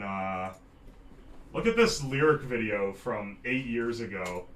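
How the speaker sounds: usual speaking voice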